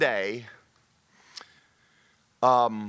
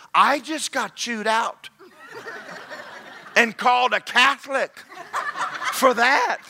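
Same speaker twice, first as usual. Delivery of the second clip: high, loud mock outrage, drawn out; milk the grievance for laughs, voice pitched up; loud, comically indignant complaint; exasperated retelling; 11 semitones higher, 4 dB louder, slower than usual. Preacher recounts being scolded, incredulous.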